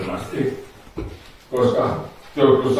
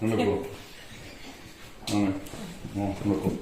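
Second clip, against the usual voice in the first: hushed sleep command; 6 semitones higher, 15 dB quieter than usual. Acted. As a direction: put them to sleep quietly. Hypnotist orders sleep.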